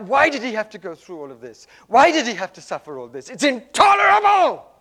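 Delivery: loud anguished lament that peaks in a high shout; crying out. Retold or imitated. Ava wailing over Rumi's death, loud anguished cry. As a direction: rail against the suffering, near breaking. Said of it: "And she cried out".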